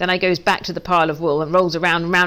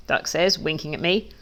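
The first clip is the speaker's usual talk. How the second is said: plain reading voice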